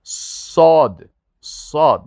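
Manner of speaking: صاد with a long heavy s and no w glide; صاد, thick drawn-out s, "ssaad"; correct Sad. Shown as a demonstration. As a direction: hold the emphatic s of صاد, then straight into the open vowel. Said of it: "واو زیاد نکنین".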